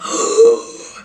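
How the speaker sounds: sudden breathless gasp of shock; sharp gasp. Retold or imitated. Seeing the ring box, a sharp gasp. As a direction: suck in air at the sight. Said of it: "And I'm like".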